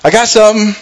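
cheeky, showing off his prize; teasing David. Retold or imitated. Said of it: "I kinda picture David coming".

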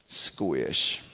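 low, soft squish sound effect; imitated squish. Squelchy noise for the congregation, low and soft. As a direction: mimic the foot squelching into the nest. Waders squelch on vermin nest.

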